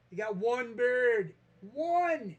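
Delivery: loud, astonished "one!"; incredulous shout. Real, not acted. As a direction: shout it in outraged amazement. Exasperated at single bird.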